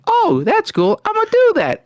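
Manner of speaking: high-pitched, eager mock quote; mock copycat colleague. Retells Chuck taking his accent idea.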